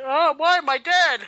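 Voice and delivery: high, loud cry of dismay; mock dismayed wail. Host imitates the look on a character's face, mock wail. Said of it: "just like".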